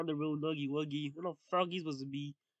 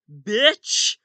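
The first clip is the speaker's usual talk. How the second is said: angry shout; 3 semitones higher, 13 dB louder than usual. Acted. Nuggy Wuggy yelling at the impostor, furious shout. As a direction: bark it out loud and furious.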